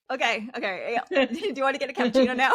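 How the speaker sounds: fast, laughing, breezy dismissal; casual brush-off. Retold or imitated. Friend at a cafe misses the serious news, breezy. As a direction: shrug it off as small talk. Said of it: "my mind would be like".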